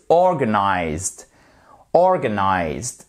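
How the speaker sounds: slow, low "Organized" with stress on OR; first-syllable stress. Shown as a demonstration. Teacher showing where stress falls, "Organized" stressed on OR. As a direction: lean on OR in "Organized", drawn out. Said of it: "Accent tonique ici".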